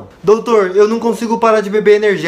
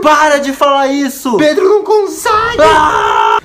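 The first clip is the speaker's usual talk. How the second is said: loud, higher exasperated yell; frustrated outburst; 6 semitones higher, 5 dB louder, slower than usual. Acted. Yelling at Pedro to stop the name puns, loud and higher. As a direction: blow up at the joke.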